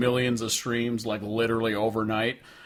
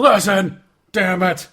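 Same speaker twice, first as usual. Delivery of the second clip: mock shout; 7 semitones higher, 6 dB louder, slower than usual. Mock scolding listeners about Moreland.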